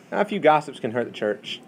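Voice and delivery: ordinary talk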